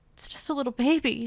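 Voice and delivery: mild acted wail of grief. Ariette finds the charred crib, a touch of wailing. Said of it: "she wailed".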